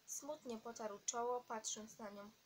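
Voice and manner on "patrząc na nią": plain reading voice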